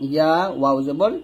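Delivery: usual speaking voice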